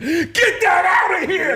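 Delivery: Tracy Morgan shouting. Retold or imitated. Tracy Morgan yelling at the kid with the notebook. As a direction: bellow it like Tracy tossing the notebook. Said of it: "And he said".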